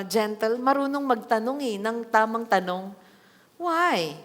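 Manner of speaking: normal talking voice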